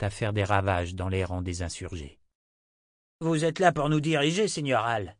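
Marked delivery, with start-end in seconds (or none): 3.2-5.1 lightly voiced, higher-pitched appeal; appealing townsman. Townsman asks his lord to lead, mildly pleading. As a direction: call up to your leader for guidance.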